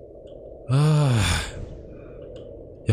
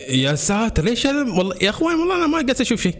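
scared high pitch; 12 semitones higher than usual. Real, not acted. Gamer in a dark horror level, frightened high-pitched complaint. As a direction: voice jumps high in fright, swearing he sees nothing.

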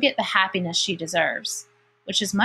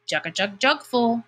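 hoarse rumble; 3 semitones higher than usual. A narrator's reading. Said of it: "rumbled a hoarse voice".

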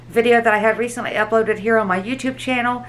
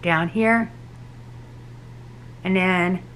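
speaking with lips stretched back; lips held open with fingers; 2 semitones lower, 5 dB quieter, slower than usual. Real, not acted. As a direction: fingers hooking mouth corners. Seen 1: Fingertips hook mouth corners, spreading lips to show braces while speaking.

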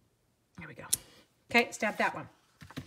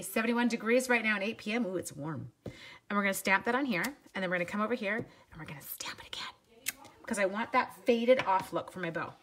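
normal talking voice

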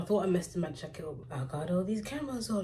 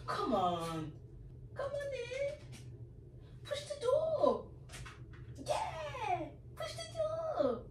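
very high, soft coaxing pet voice; high, soft sing-song call to a pet; 8 dB quieter, much slower than usual. Real, not acted. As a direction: coax the dog in sweetly.

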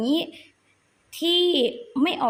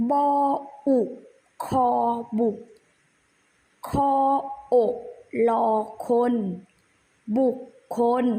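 spelling drill. Shown as a demonstration. Teacher leads children through spelling a word, measured recitation. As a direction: separate syllables with pauses between.

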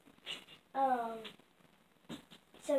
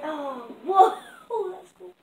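startled shout; 6 semitones higher, 12 dB louder, slower than usual. Real, not acted. Surprise explosion in game.

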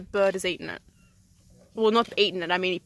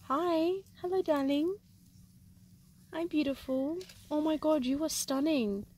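softer, tender coo to a bird; 5 semitones higher, 7 dB quieter than usual. Real, not acted. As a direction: sweet-talk the bird gently. Sweet talking the bird.

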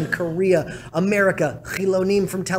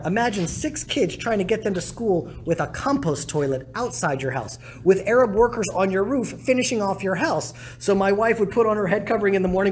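ordinary delivery

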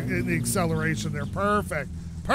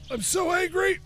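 higher, whiny mock anger on "angry"; 10 semitones higher than usual. Real, not acted. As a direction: complain in a pitched-up mock fury.

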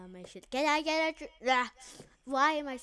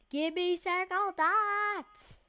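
greedy squeal; 8 semitones higher, 5 dB louder than usual. Pretend. Demanding dots from player.

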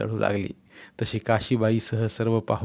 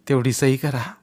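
plain voiced begging of सही करा; old man asking him to sign; voiced plea. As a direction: say सही करा aloud, pleading. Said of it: "पायावर डोकं ठेवून म्हणाला".

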